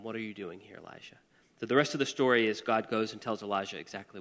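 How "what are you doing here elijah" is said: soft, breathy whispered question; gentle whisper. A narrator's reading. God whispering to Elijah at the cave mouth. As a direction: whisper it close, tenderly. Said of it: "came a gentle whisper".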